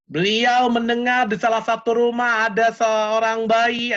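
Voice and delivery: ordinary talk, nothing marked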